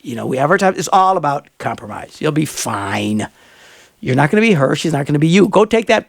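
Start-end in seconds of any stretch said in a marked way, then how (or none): 2.2-3.3 reassuring. Real, not acted. Reassuring the listener.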